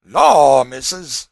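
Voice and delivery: put-on loud, drawn exclamation of an old servant; Cudjoe. A narrator's reading. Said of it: "said Cudjoe".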